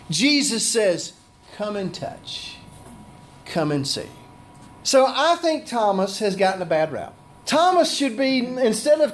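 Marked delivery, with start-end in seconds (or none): 1.6-4.1 soft tender invitation. Acted. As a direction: coax gently, almost a hush. Jesus inviting Thomas.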